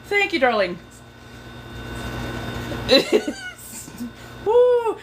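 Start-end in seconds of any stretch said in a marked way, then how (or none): 1.5-3.5 airy high-pitched cackle; high breathless laugh. Real, not acted. Keeps laughing. 4.5-5.0 loud high whoop; excited whoop. Real, not acted. Exclaims after laughing.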